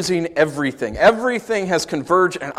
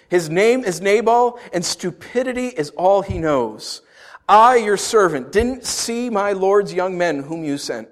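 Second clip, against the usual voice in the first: scripture reading; 5 semitones higher, 4 dB louder than usual. Acted. Reads Abigail's scripture plea.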